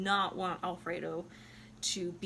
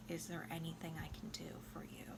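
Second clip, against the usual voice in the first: whisper; 3 semitones lower, 12 dB quieter, faster than usual. Retold or imitated. Retold opera, lover's hushed offer.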